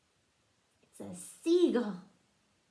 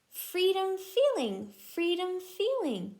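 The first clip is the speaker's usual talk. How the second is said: Freedom feeling with each F leaned on; stress the F sounds; 7 semitones higher, 3 dB louder than usual. Shown as a demonstration. Showing her child alliteration, stressing the F in freedom feeling. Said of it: "They both start with the letter F".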